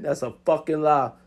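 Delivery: ordinary delivery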